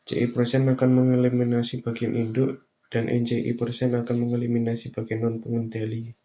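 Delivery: ordinary talk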